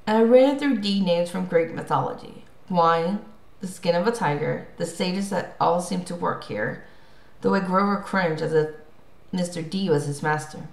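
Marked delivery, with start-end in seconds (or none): none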